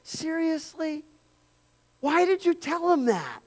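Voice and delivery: lightly voiced fed-up dad; exasperated father. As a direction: play Jacob fed up with his son. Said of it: "He wanted to say to his kid".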